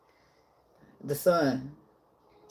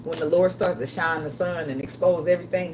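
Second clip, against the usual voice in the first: ordinary delivery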